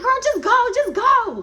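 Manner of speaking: high, loud urging; urging shout. Retold or imitated. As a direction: urge her loudly and high. Urging friend loudly.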